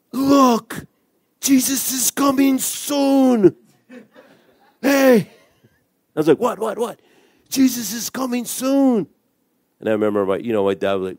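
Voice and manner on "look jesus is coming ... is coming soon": put-on high-pitched, breathless childlike excitement; gasp, then cry it out high and thrilled; excited. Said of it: "he was excited".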